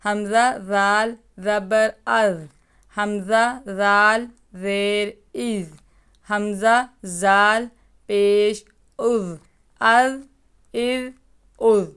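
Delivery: teacher sounding ار with a thin ذال, no hiss; thin ذال drill. Shown as a demonstration. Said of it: "تلفظ، مخارج، صفات".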